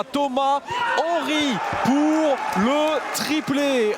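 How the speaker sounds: goal yell. Real, not acted. Hat-trick goal call.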